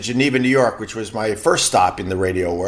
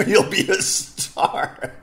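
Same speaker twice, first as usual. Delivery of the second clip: laughing mock; 6 semitones higher, 3 dB quieter, much slower than usual. Mocking the job offer.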